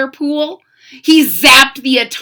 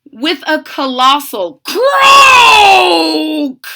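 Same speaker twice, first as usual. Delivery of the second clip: loud, slow booming narration; dramatic narration; 7 semitones higher, 8 dB louder, much slower than usual. A narrator's reading. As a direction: boom the big moment.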